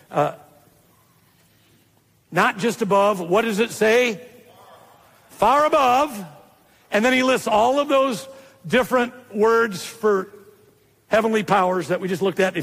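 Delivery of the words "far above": high, drawn-out stress on "Far"; emphatic answer. Real, not acted. Preacher answering his own question for the congregation, emphatic. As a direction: lift "Far above" high and stretch it.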